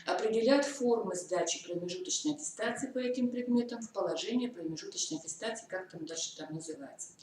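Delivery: normal talking voice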